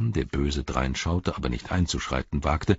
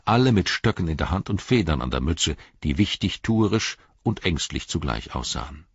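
ordinary talk, nothing marked

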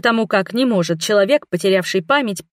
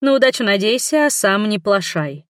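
ordinary delivery, nothing marked

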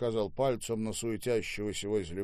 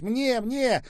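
very high, loud, eager cries; shouting; 7 dB louder, slower than usual. A narrator's reading. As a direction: clamour to be served first. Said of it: "закричал Серёжа". (звонкий нетерпеливый крик)

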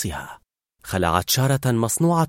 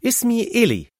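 high, cheerful self-introduction; 11 semitones higher than usual. A narrator's reading. Girl finally introducing herself to Carl, cheerfully. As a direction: introduce yourself merrily, pitched well up. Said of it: "فقالت بمرح".

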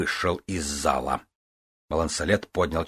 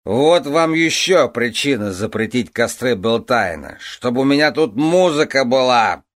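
put-on slurred drunk; 6 semitones higher, 6 dB louder than usual. Drunk king continues.

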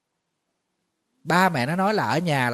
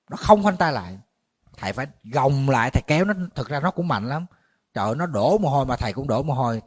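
ordinary talk, nothing marked

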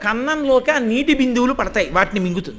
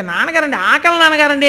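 a child's whine pitched higher, imitated; mock child crying; 3 semitones higher than usual. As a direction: lightly play the hungry boy begging his father. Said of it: "అని ఏడుస్తాడు".

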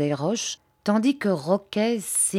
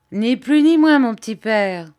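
higher, louder cocky quip; forced Parisian banter; 5 semitones higher, 7 dB louder than usual. A narrator's reading. Reporter jokes to Bobby to hide his fear, loud forced banter. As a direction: put on a brave joking swagger. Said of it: "s'efforçait de retrouver sa gouaillerie parisienne".

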